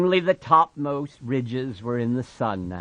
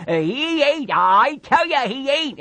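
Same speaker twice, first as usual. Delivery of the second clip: higher, louder denial; desperate Lenny; 7 dB louder than usual. Acted. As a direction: refuse to hear it. Lenny covering his ears.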